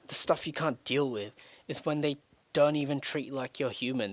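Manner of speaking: ordinary delivery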